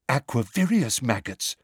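mildly excited character voice; a touch of eagerness; lightly excited announcement. A narrator's reading. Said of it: "said Luna excitedly".

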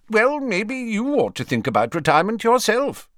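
high, loud, blunt comeback; blunt retort. A narrator's reading. Old man turns the retirement question on Dumbledore, bluntly. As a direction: throw it back bluntly, pitched well up. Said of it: "said Slughorn bluntly".

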